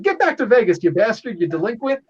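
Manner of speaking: half-serious high shout; mock yell. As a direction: holler it as a hammed-up parting insult. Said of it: "Samson yells at him".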